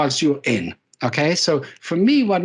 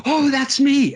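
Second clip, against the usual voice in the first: sudden realization; 10 semitones higher, slower than usual. Retold or imitated. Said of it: "I go".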